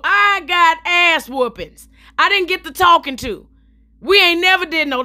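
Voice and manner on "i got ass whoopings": slow emphatic. Shown as a demonstration.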